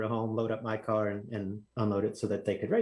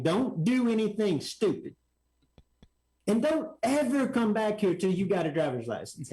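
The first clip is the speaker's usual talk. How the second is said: fuming orders; 8 semitones higher than usual. Retold or imitated. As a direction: scold the kid firmly, each order spelled out. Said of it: "he's kinda fuming".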